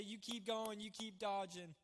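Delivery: half-earnest sideline encouragement; mock cheering from bench. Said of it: "just cheer me on from the bench".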